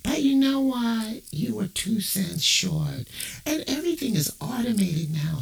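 call-center rep faking sympathy; phone rep Mindy. Acted. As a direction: gush over the caller with fake pity. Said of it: "she comes in".

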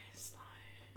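breathy whispered warning; whispered aside. Real, not acted. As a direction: whisper it under your breath. Unvoiced aside.

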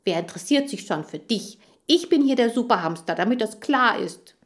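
snappy, self-important challenge; quarrelsome retort. Acted. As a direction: put the rival down and claim the title. Hamster quarrels with rival Chan.

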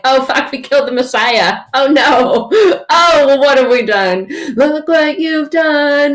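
dramatic guilty horror; hammed-up panic. Crowd after the crucifixion, mock panicked wail. Crowd realizing they killed Messiah.

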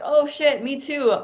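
high-pitched happy surprise; retold excitement. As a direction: act out an eager me-too moment. Retold delight at shared destination.

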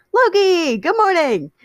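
high, bright, bubbly hello; cheerful greeting. Acted. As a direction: greet him sing-song and happy. Patton greets boyfriend cheerfully.

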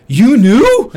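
high, loud, betrayed yelp; incredulous shout. Retold or imitated. To his brother who kept the secret, incredulous shout. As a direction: blurt it out in outrage.

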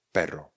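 "Perro" as pero, one short R; low, falling "Perro" with the R tapped once; single-tap R. Shown as a demonstration. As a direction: keep the R in "Perro" brief. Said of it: "Pero s jedním R".

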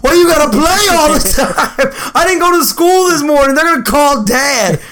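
very high, wailing complaint; hammed-up, drawn-out high wail; mock whining. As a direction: imitate a wronged kid brother whining. Imitates tricked brother's whining complaint.

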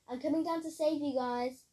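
high, loud rescue call. A narrator's reading. Kira calls to friends tumbling downhill, high shout. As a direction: shout down to them, pitched right up.

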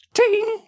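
high tinny spittoon ding; spit ding. Retold or imitated. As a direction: mouth sound of tobacco hitting a spittoon. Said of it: "That was tobacco noise".

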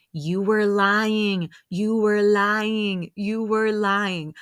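drawn-out, deliberate repeated charge; slow, pointed accusation. Retold or imitated. Host confronting her over lying, slow and pointed. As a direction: spell it out slowly, imitating an accuser.

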